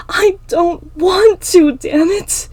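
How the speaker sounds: high, slow, loud outburst; anguished scream. Acted. Emma refuses to kill as tears fall, anguished scream. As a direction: force out each word at a scream.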